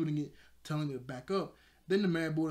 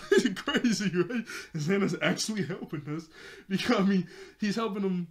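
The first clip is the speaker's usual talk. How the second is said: laughing; 4 semitones higher, 7 dB louder than usual. Real, not acted. Laughing at Xana helping them.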